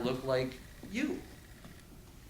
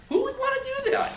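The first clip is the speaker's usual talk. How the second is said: much higher, louder shouted disbelief; shouted outrage, pitched way up; incredulous shout; 3 dB louder, faster than usual. Real, not acted. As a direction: fling the question out like you can't believe it. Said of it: "repeated, shouted".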